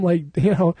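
normal talking voice

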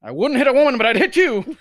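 high, loud joking threat; mock shout. Friend teasing another with a loud mock threat. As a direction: yell it as a cheeky joke.

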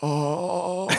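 long, high wail of pain; drawn-out hammed-up "oh"; mock pained moan. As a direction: groan like a cartoon casualty. Acts falling man hitting rock.

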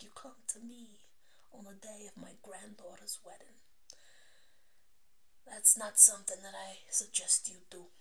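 hushed Godfather-style mobster; mob boss. Acted. Mob boss confronted on his granddaughter's wedding day, hushed impression. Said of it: "Like watching The Godfather".

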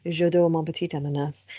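imitating a confident, smooth French accent. Retelling Carl Barron's bit on how his French sounds, mock French accent. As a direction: parody a Frenchman who thinks he sounds amazing. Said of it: "I think I sound amazing in French".

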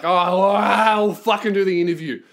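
long drawn-out groan into grudging consent; hammed-up reluctant giving in; mock exasperation. Imagined reluctant rapper giving in.

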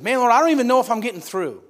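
loud, high outburst of exasperation; frustrated. Retold or imitated. Boy to God after repeated prayers, frustrated. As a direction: imitate a boy fed up with praying. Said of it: "I got so frustrated".

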